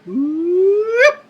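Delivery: sudden high squeaky "oop"; a startled little high-pitched yelp. Retold or imitated. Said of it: "so Tom Hanks went".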